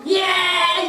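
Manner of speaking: demonstrated shout. Shown as a demonstration. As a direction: shout it out in one long breath. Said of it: "이렇게 소리 지르지만". (길게 소리 지르는 시범)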